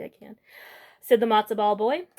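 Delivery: plain reading voice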